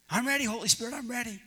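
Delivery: high, eager reply; acted desperate readiness; Jack. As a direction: answer up to the rescuer, all eagerness. Jack eagerly answers rescuer.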